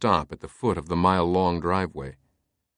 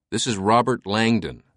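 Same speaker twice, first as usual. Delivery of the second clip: ordinary delivery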